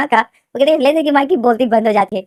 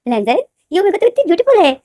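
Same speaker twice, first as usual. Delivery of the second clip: high, bright delighted gush, smiling; 7 semitones higher, 3 dB louder than usual. Acted. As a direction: marvel at the beautiful omega. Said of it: "इतनी smile करती है". (मुस्कुराते हुए खुशी से)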